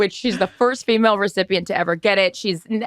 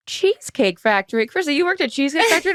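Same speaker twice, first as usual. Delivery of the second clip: surprised dad; 8 semitones higher than usual. Retold or imitated. Dad reading bio, surprised.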